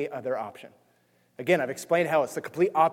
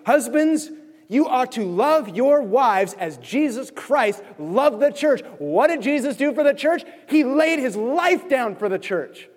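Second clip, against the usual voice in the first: high, loud sermon delivery; fired-up preaching; 7 semitones higher, 4 dB louder, slower than usual. Acted. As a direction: preach it out with full conviction. Preaching exhortation to husbands.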